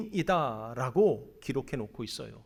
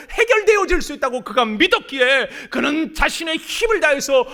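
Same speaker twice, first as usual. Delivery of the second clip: loud, higher impassioned preaching; preaching at full force; 9 semitones higher, 11 dB louder than usual. Acted. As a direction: drive it home with fervor. (열정적인 설교)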